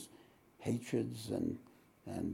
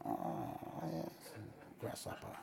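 mock Zen master; 13 dB quieter, slower than usual. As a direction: breathe it like a movie kung fu master. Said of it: "learn how to speak like this".

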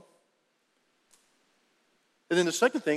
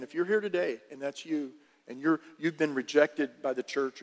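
usual speaking voice

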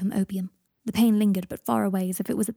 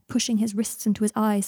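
ordinary delivery

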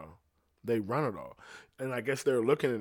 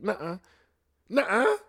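playful high nuh-uh, climbing higher and louder; mock refusal; 10 semitones higher, 7 dB louder than usual. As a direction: refuse like a drunk buddy who won't do the trick. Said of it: "I don't know why I talked like that".

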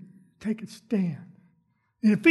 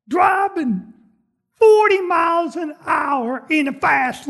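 loud, higher impatient yelling; yelling at a slow driver; 5 semitones higher, 7 dB louder than usual. Acted. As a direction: shout at the car ahead to move.

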